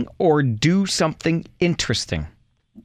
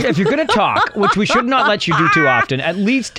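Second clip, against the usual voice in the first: scolding parent; 6 semitones higher than usual. Pretend. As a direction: tell him off like a parent at the table.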